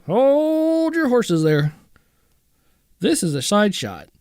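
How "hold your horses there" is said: high, slow, stretched out; drawn-out exclamation. Real, not acted. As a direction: hold it way up and draw it out. Reacting to guitar listing.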